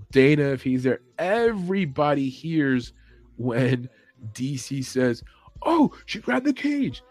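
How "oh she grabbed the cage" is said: high, slow commentator yell; mock yell. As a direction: imitate him shouting it to the whole room. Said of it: "was yelling about an obvious thing".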